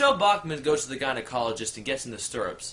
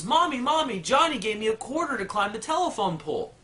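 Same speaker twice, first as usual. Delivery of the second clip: put-on high, eager little girl calling mom; little girl excitedly telling mom; 8 semitones higher than usual. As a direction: play a giddy little girl running in. Little girl runs home.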